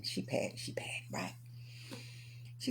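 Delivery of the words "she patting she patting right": whispered aside; softly, almost no voice. Real, not acted. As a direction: hush it like a secret.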